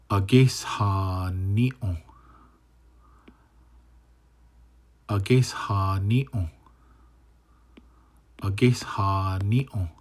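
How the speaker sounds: slow, careful "Ages ha ni on"; teacher modeling a new word; slow model reading. Shown as a demonstration. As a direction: draw out "Ages ha ni on" for learners. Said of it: "Next one".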